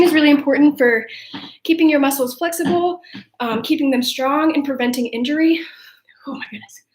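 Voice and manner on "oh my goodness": winded, barely voiced whisper; real exhausted whisper after exercise; breathless whisper. Real, not acted. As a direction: gasp it out with no breath left. Winded after squat jumps.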